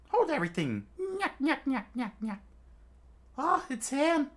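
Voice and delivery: high, squeaky, laughing call from the back; cackling rat captain. Acted. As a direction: cut in gleefully like a sneaky rat. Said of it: "someone screamed out from the background".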